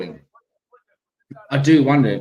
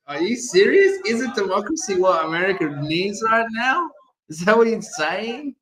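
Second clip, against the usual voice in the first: higher, scoffing disbelief; mock incredulous; 7 semitones higher than usual. Mocking the president's words to the chat, higher incredulous voice. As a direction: pitch it up like you can't believe your ears. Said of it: "saying, like".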